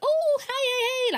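high, squeaky always-happy voice; mock cheery greeting. Mocking a forever-happy person to her friends, high and chirpy. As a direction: chirp it like someone who is happy all the time. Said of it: "like, happy, happy".

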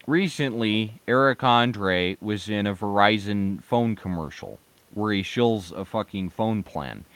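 usual speaking voice

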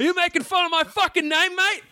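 high, hammed-up Australian outrage; mock-indignant Australian accent. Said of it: "I think he's Australian".

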